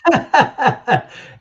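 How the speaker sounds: big high burst of laughter; loud laugh. Real, not acted. Reacts to friend's joke text.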